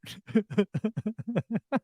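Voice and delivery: helpless high giggling fit; laughing. Real, not acted. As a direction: crack up in a long run of giggles. Laughing at the misprint.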